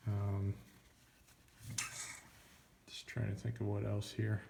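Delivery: drowsy, trailing half-asleep voice. Real, not acted. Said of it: "Sorry, I'm, like, half asleep".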